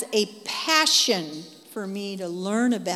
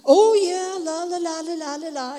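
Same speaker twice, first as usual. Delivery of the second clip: mock phone chatter; 7 semitones higher, 8 dB louder than usual. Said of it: "You know how you do".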